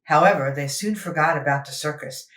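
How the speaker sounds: usual speaking voice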